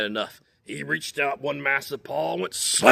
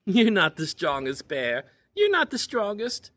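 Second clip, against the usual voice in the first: high, loud teasing chipmunk; small teasing voice; 9 semitones higher, 5 dB louder than usual. Acted. Hidden chipmunk taunting Bear in a high teasing voice. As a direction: taunt him from a hiding spot. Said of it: "he heard a small voice".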